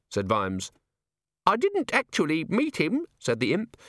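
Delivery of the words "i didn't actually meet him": very high, chirpy little device voice; tiny imp chiming. A narrator's reading. The pocket organiser imp announcing itself to Vimes, chirpy. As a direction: sing out the chime like a small gadget.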